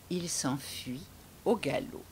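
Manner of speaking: hushed storyteller whisper; whispered narration. A narrator's reading. Narrator whispering to children, hushed and low. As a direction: breathe the line like a secret.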